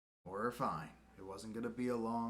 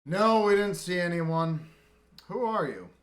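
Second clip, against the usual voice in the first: louder, higher NPC reply; in-character reply; 7 semitones higher, 11 dB louder than usual. Acted. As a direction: answer as the wary stranger. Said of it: "he just goes".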